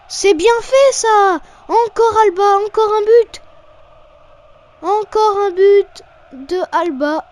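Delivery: excited commentary. Real, not acted. Goal celebrated in commentary.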